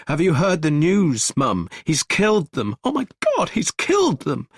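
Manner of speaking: high, loud panicked yelling; frantic high shouting. Acted. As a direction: shout the terrible news in a panic. Gregory shouting the news.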